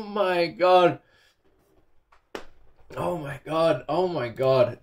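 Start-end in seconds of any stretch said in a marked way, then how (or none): none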